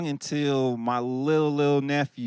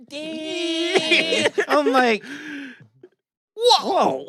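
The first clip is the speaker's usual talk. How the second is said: mock impressed damn; 11 semitones higher, much slower than usual. Imitating reaction to the song.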